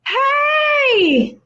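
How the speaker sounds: high shouted hey from afar; calling out. Retold or imitated. Neighbor on her porch hailing the narrator, high call. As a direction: call across the yard to her.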